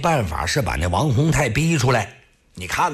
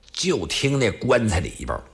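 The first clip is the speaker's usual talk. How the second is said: ordinary delivery, nothing marked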